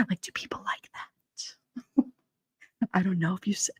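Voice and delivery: soft unvoiced ASMR whisper, slowing down. Joking with chat about mic sounds, mock ASMR whisper. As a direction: tease them with a breathy ASMR whisper. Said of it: "You like the A S M R?".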